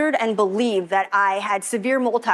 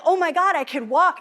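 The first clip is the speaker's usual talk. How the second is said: overjoyed realization; 7 semitones higher than usual. Retold or imitated. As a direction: blurt it out in happy disbelief. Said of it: "And I was just like".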